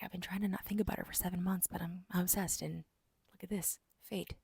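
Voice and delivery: softly acted lovesick obsession; hushed imitation of a smitten man; hushed confession. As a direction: murmur it as his secret admission.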